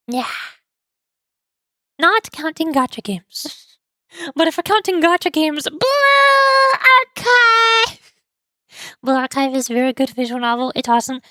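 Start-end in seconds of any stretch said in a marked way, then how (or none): 5.7-8.1 high, drawn-out cheer; excited shout. Real, not acted. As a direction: belt the name out with glee. Excited shout of favorite game.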